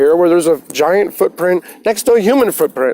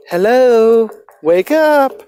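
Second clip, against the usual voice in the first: higher, bright wake-up call to yourself; mind calling out; 5 semitones higher, much slower than usual. Pretend. Talking to viewers, the mind says wake up, calling out higher. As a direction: call out like your own mind knocking on the door.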